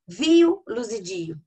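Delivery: "Vi-o, luzidio" pitched high, "Vi-o" punched loud; rhyme stressed. Shown as a demonstration. Teacher showing how "Vi-o" rhymes with "luzidio", high emphatic endings. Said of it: "rimando com luzidio".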